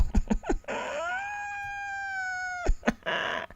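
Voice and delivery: a sudden, high laugh. Real, not acted. Laughs at own burp comment.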